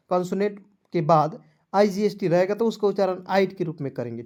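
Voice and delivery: normal talking voice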